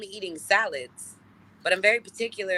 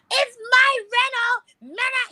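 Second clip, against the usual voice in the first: very high, loud, drawn-out squeal; tantrum; 5 dB louder, much slower than usual. Retold or imitated. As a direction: screech it like a brat denied the keys. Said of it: "the whole tantrum".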